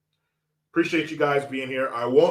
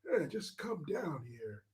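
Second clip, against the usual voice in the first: put-on creepy old man; 6 semitones higher, 3 dB louder than usual. Old man invites little boy to sit on his knee, creepy. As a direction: coax like a doddering, creepy elder.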